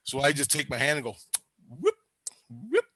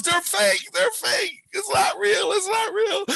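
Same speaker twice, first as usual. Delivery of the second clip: frantic reassurance; 3 dB louder, slower than usual. Retold or imitated. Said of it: "my wife goes".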